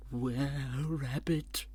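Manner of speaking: slow, mock-ominous stretched words; hammed-up title reveal. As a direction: draw out the title theatrically. Hammed-up film title announcement.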